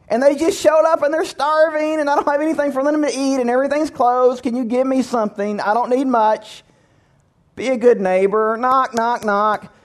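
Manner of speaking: high-pitched comic pleading; Pat. Acted. Neighbor begging food for out-of-town guests, high pleading voice. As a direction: pester them in a high, put-on voice, easing down gradually. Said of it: "he talked like this".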